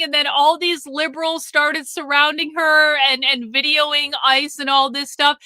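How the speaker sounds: normal talking voice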